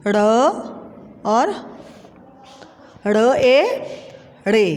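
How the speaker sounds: slow, drawn letter-by-letter spelling. Shown as a demonstration. Teacher spelling a word for pupils, slow and drawn out. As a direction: sound out each letter slowly with long gaps. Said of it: "अब देखें".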